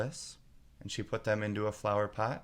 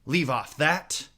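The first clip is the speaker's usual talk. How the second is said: higher, louder, slow furious scream; 6 semitones higher, 9 dB louder, slower than usual. A narrator's reading. Queen screams at the bowing gardeners. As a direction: explode at the bowing. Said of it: "screamed the queen".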